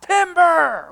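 high, loud falling holler; big ringing yell; warning shout. Shown as a demonstration. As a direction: shout it like a lumberjack warning everyone below. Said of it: "Why do people shout".

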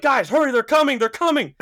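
loud, high-pitched urgent warning; panicked warning. Retold or imitated. Speaker warning friends that they're coming, panicked. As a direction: rush them along in mock panic.

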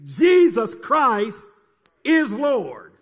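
Jesus Christ stretched slow and loud; preacher's loud drawn-out declaration; proclaiming. Acted. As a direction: ring out Jesus Christ slowly. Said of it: "Say it again".

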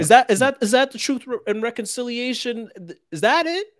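high, loud incredulous question. Acted. Repeating the question to the group, high and incredulous. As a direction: pitch it way up in disbelief.

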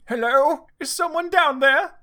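put-on high, loud girl's voice; girl calling down. A narrator's reading. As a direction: call down from upstairs, pitched right up. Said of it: "said a voice coming from the floor above".

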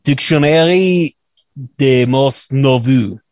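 slow, careful attempt at a French accent. Shown as a demonstration. Host warns listeners, then tries slow careful French. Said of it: "have your ears murdered".

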